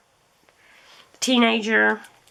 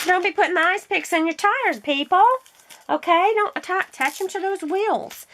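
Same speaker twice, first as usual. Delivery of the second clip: higher-pitched playful warning; mock scolding; 8 semitones higher than usual. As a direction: scold the viewers in jest, just after a laugh.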